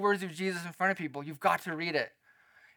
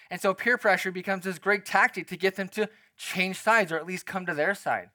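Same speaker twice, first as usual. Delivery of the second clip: ordinary talk, nothing marked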